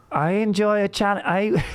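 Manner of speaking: high, put-on German-Aussie surfer; hammed-up German Australian surfer voice; German Australian dude voice. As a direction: play a stiff German surfer bro. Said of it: "he'd have the chillness of Austrian".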